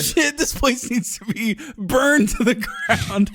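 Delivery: high, mock-alarmed official; panicked EPA chief. Imitating the EPA chief facing tap water, mock panic. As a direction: imitate a horrified official, stretching the last words. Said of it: "especially the E P A chief, who's like".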